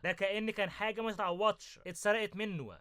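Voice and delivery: ordinary delivery, nothing marked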